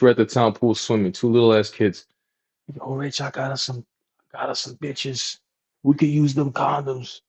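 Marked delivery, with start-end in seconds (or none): 2.7-7.2 soft, secretive imitation of a friend; hushed scheming. Friend tipping off Rich at the pool, hushed.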